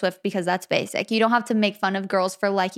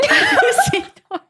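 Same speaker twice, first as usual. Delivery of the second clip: high, louder burst through laughter; cracking up; 5 dB louder than usual. Real, not acted. Defending people who like horses, cracking up. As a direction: squeal it while laughing.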